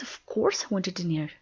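ordinary delivery

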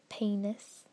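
"penis" drawled as PAY-nus, Southern vowel; Southern belle drawl on the vowel; Southern belle accent. Shown as a demonstration. She reads the word list as a Southern belle, Southern accent. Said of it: "switching to my Southern Belle".

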